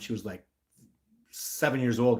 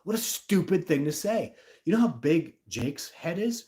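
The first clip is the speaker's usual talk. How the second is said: higher, indignant outburst; overly emotional; 5 semitones higher than usual. Real, not acted. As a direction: vent real exasperation at the stupidity. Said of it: "here's where I get overly emotional".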